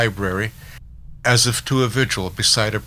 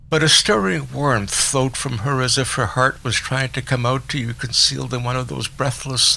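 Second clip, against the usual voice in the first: ordinary delivery, nothing marked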